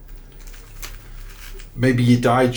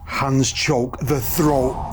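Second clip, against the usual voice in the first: slow reading; 2 semitones higher, much slower than usual. Real, not acted. Reading spirit box words.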